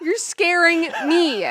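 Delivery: high, slow whine through tears; tearful frightened wail; crying. Real, not acted. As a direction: plead tearfully, drawing the words out. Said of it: "You're actually crying?".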